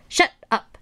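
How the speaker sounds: high cry at no real volume; mock scream. A narrator's reading. Toad silences the announcing voice, mock scream. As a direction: cut in with a high, contained outburst. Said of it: "screamed Toad".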